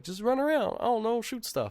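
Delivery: high, sing-song dismissive tone; mocking dismissive. Gamer mocking the basic gameplay, high and dismissive. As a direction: shrug off the game as simple.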